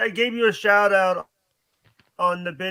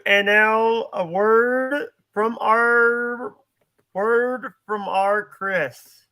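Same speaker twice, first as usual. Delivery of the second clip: announcer; 3 semitones higher, 5 dB louder, much slower than usual. Acted. Mock announcer intro.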